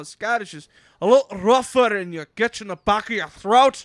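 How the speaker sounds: loud, high, rough Scottish brogue; rougher Scottish accent. Shown as a demonstration. Showing how Scottish differs from Irish, rough Scottish accent. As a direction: push a rough Scottish accent from the back of the throat. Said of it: "They said it sounded like a Scottish voice".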